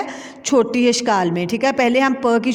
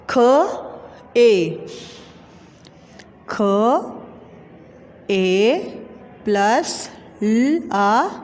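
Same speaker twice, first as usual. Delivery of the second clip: slow, drawn letter-by-letter spelling; 2 semitones lower, much slower than usual. Shown as a demonstration. Teacher spelling a word for pupils, slow and drawn out. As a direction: sound out each letter slowly with long gaps. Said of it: "तो क्या अल्फाज बना".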